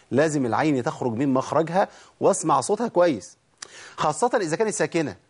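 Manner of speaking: ordinary talk, nothing marked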